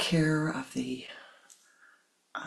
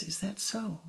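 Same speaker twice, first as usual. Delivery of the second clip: soft, unbothered reply; calm acceptance; 5 semitones higher, 9 dB quieter than usual. Acted. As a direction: let it go gently.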